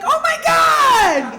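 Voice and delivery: loud, high, drawn-out squeal of words; mock thrill. Fiancée reacting to Christmas Day proposal, over-the-top shout. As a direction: gush it slowly at full volume. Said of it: "I was like".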